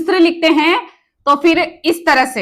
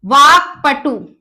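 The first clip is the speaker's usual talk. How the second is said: वाक्पटु slowly, with the halant on क clearly heard; slow careful demo; slower than usual. Shown as a demonstration. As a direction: stress the joined क in वाक्पटु. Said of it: "क के नीचे क्या आता है?". (धीरे-धीरे: वाक्पटु)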